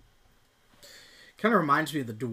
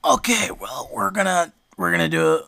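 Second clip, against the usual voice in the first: loud, over-projected line delivery; mock yelling; 9 dB louder than usual. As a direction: belt it out like someone too loud for the microphone. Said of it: "but somebody was yelling".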